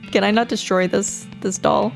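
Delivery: usual speaking voice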